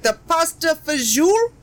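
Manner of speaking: loud, high mock-Italian flourish; hammed-up Italian, rising at the end; bad Italian accent. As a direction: overdo a shitty Italian accent. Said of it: "loudly doing a shitty Italian accent".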